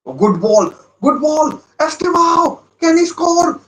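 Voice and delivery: building from excited to shrill high yelling; live fan commentary as the chance builds; rising excitement. Real, not acted. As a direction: ride the attack with rising hope. Attack building toward goal.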